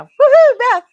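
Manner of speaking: loud, high woohoo; excited whoop. Real, not acted. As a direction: whoop with joy. Celebrating a sale.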